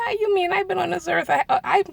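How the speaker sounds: squeaky playful objection; high mock protest. Answering her friend who teased her about age, high. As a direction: protest with exaggerated surprise.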